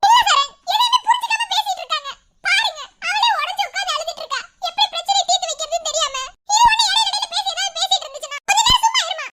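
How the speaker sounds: high, heated complaint; angry. Acted. Daughter defending her crying sister against mother, angry. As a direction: vent exasperation at the interference. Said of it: "கோவப்படாத".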